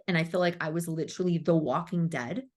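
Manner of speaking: normal talking voice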